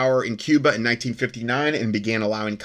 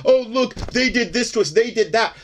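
much higher, whiny playing-the-victim voice; mock victim whine; 11 semitones higher than usual. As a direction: pitch it up like someone crying foul for sympathy. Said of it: "They're doing it to get sympathy from the world".

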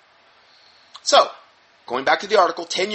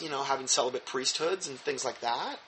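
ordinary talk, nothing marked

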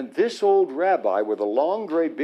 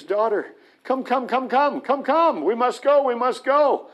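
urgent calling; 8 semitones higher than usual. Retold or imitated. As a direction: hurry the daughter along in excitement. Rabbi urgently calling his daughter.

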